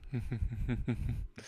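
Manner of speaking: a soft run of short chuckles after a plan. Acted. Bell chuckles after announcing his plan.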